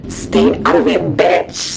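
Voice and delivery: loud, forceful snap; loud, angry outburst hitting every word; angry yell. Acted. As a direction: yell it in hammed-up anger. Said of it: "I yell in anger".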